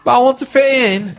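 high, loud, hurried mock plea; mock pleading newcomer. Newcomer who wants to fit in, mock pleading. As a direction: plead in a hammed-up squeal.

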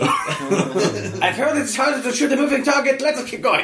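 high-pitched, comic in-character call; put-on high voice urging others on. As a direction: pipe it up high and jokey. Player quips in high put-on voice.

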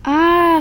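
loud high scream of fright. A narrator's reading. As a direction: shriek the ah out loud. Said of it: "screamed the babies".

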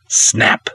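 whispered sharp snap; a crisp breathy snap effect; rope snap sound. Acted.

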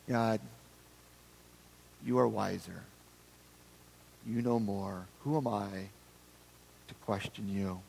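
low, hushed acted prayer; humble submission. Job submitting to God's wisdom, acted low and humble. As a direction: yield softly, with reverent pauses.

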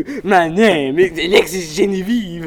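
put-on playful French accent with a laugh. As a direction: introduce yourself like a French girl. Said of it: "when we did French accents".

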